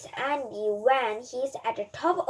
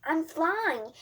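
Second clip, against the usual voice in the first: higher, louder playful zooming; excited shouts; 5 semitones higher, 3 dB louder, slower than usual. A narrator's reading. Boy flying round the room, playful shouting. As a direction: shout it like a kid pretending to fly. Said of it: "runs around the room and shouts".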